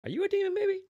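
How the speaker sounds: high, soft suspicious question; mock high suspicion. Lady realizes her baby is a demon, high soft question. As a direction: squeak the question in mock alarm.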